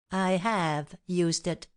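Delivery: slow, each word set apart; deliberate word-by-word emphasis; calm, emphatic. A narrator's reading. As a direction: state it calmly, weighting every word. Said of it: "in a calm voice, emphasizing each word".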